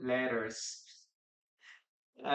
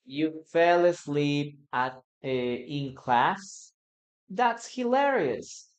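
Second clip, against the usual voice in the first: dictation pace; slower than usual. Shown as a demonstration. Teacher dictating the sentence for learners to write. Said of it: "Listen and write".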